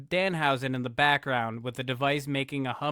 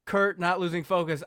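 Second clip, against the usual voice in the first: ordinary talk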